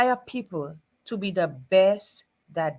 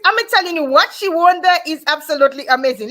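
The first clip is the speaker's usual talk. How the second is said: much higher and louder, gushing with real excitement; excited; 8 semitones higher, 9 dB louder than usual. Real, not acted. Host gushing to viewers about a guest's amazing win, excited. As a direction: rave about it like it's unbelievable news.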